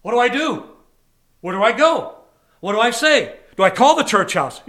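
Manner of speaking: mock-frantic indecision; acted desperation. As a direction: fire off questions as if at a loss. Acts out being stuck, not knowing.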